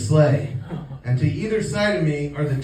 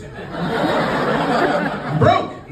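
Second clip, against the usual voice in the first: laughing exclamation; 9 semitones higher, 5 dB louder than usual. Real, not acted. Joke punchline, laughing burst.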